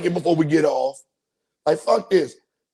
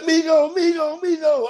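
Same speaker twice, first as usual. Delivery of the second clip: calling out; 9 semitones higher than usual. Retold or imitated. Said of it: "They said".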